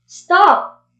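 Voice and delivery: emphatic imperative; firm command. Shown as a demonstration. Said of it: "if you are doing a command".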